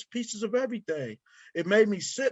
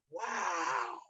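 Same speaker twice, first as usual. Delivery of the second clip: hushed awe; 11 dB quieter than usual. Real, not acted. Awed by what actors do.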